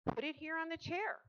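high, loud instruction; mother directing. A narrator's reading. Wife telling husband where the carton goes, directing.

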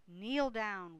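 usual speaking voice

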